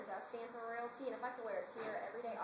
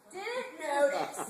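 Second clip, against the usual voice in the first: mock outrage; 3 semitones higher, 10 dB louder, much slower than usual. Real, not acted.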